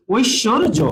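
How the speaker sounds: ঐশ্বর্য as oish-shor-jo, ending on a j sound; teacher's careful model of ঐশ্বর্য; j sound demo. Shown as a demonstration. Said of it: "বর্গীয় জ হয়ে যায়".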